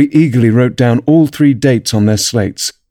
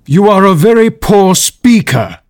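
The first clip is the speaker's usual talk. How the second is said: slow, high, emphatic put-down; scornful king; 9 semitones higher, 4 dB louder, slower than usual. A narrator's reading. The king rebukes the pleading Hatter, scornfully. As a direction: pronounce the verdict slowly and scornfully.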